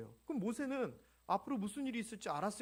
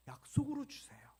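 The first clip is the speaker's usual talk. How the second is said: usual speaking voice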